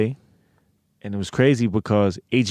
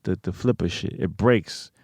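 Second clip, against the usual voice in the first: ordinary talk, nothing marked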